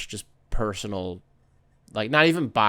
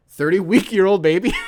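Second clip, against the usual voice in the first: incredulous echo; 8 semitones higher, 8 dB louder than usual. Real, not acted. Host catching his own slip, amused disbelief.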